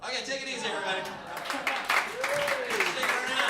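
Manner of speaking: loud, high sign-off to the audience; host signing off to the crowd; show sign-off. Real, not acted. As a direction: wrap up the show loudly.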